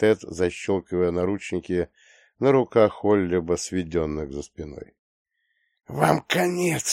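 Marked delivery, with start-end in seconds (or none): 5.9-6.9 hissed threat; hissing threat. Acted. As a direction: hiss it through the teeth. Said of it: "шипит он".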